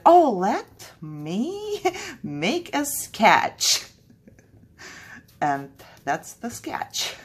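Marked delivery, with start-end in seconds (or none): 0.1-3.9 slow, higher, with a laugh breaking through; mock breezy past self. Artist mocking her own careless idea, laughing. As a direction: play your past self, carefree and amused. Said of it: "I was like".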